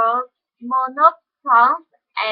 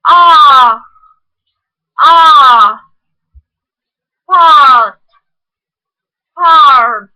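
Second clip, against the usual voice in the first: part with a long ah, shouted; shouted ah drill; 9 dB louder, much slower than usual. Shown as a demonstration. Teacher shouts the long ah vowel. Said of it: "the monophthong".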